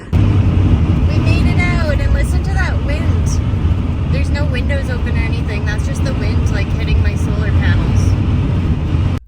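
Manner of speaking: loud over wind. Real, not acted. Relieved in loud windy van.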